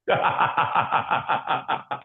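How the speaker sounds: long, breathy laugh; helpless laugh at your own story. Real, not acted. Laughing at being fixed by a mentor, long laugh.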